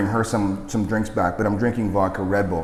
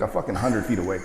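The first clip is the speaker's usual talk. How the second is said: normal talking voice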